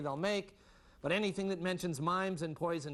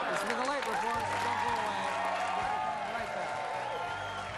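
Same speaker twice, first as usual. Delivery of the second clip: louder, higher host voice; announcer sign-off; 5 semitones higher, 4 dB louder than usual. Acted. Host sends the Late Report to a break, announcer style. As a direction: call out the sign-off to the audience.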